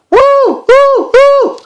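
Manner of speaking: very high, loud hooting owl. Acted. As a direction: hoot it out like a night owl. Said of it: "she knew it was time to wake up and say".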